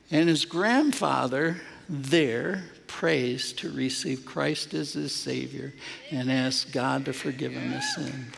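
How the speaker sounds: ordinary delivery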